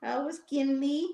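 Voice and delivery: sing-song prompting of a pet; pet talk. Real, not acted. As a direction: coo each word out long and high. Prompting pet to greet others.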